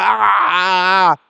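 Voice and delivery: drawn-out anguished cries of a possessed man; demon-possessed man howling slowly; possessed wail. Acted.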